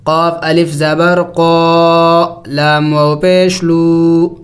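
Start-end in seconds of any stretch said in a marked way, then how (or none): none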